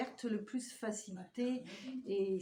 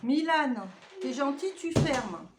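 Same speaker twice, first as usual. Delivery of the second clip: loud request across the room; calling to Milan; 3 semitones higher, 6 dB louder than usual. Real, not acted. To Milan, asking him to shut the door, loud call. Telling her son to close door.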